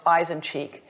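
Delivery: normal talking voice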